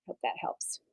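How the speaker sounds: breathy unvoiced whisper; whispered aside. Real, not acted. Teacher whispering a hope to the class. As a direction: murmur it to yourself.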